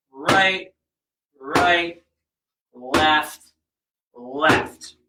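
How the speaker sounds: slow, spaced-out "right, right, left, left". Shown as a demonstration. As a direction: draw out each "right" and "left" with pauses. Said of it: "go so slow that you do it correct".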